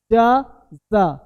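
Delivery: पिज़्ज़ा stretched very slow and loud with a long z sound; drawn-out pizza. Shown as a demonstration. Teacher to students, stretching पिज़्ज़ा slowly and loudly. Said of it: "देखो ध्यान देना".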